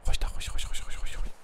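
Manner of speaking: rapid, soft whispered soothing; hushed whisper. Real, not acted. Player calming things down, urgent soft whisper. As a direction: whisper it over and over to hush. Hushing a creature.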